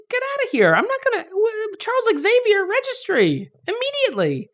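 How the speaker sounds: very high-pitched comic panic; mock alarm. Reacting to mutants with out-of-control powers, in high mock alarm. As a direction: squeal the orders in mock outrage.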